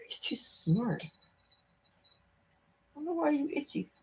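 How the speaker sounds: soft whispered aside; hushed aside. Real, not acted. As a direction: whisper so the cat won't notice. Whispered aside about the cat.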